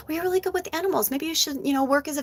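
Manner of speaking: high, quick imitation of sister; mock sister. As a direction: rush out the helpful suggestion. Sister suggesting vet work.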